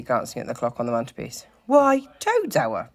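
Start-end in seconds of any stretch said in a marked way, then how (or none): 1.7-2.9 high, drawn-out "Toad's hour"; higher, slow emphatic reply. A narrator's reading. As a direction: stretch out Toad's name grandly. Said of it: "replied the badger".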